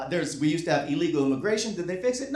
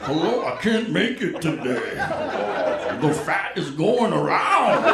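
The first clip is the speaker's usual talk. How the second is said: slow, louder, faked sick voice; mock sick call; 2 semitones lower, 5 dB louder, slower than usual. Calling the boss to skip work, in a slow, put-on sick voice.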